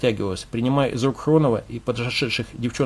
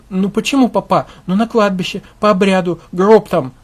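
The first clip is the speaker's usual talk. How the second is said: high, pleading, on the verge of tears; tearful husband; 6 semitones higher, 5 dB louder than usual. Acted. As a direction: plead weakly through grief.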